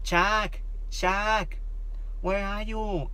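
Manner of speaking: put-on high little-kid voice; toddler voice. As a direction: imitate a small niece calling. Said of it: "siempre me llama".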